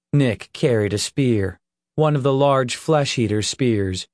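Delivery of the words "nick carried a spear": plain reading voice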